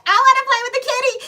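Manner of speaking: put-on high, fast, loud childish squeal; gleeful cat-cage shaker. Taunting the caged couple, a high fast squeal. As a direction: squeal it like a kid grabbing at the cat.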